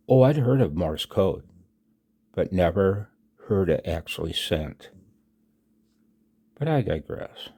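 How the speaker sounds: usual speaking voice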